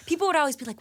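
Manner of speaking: ordinary delivery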